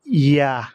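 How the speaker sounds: ইয়া pushed out straight with a firm fall. Shown as a demonstration. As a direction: say ইয়া directly, falling. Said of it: "সোজাসুজি".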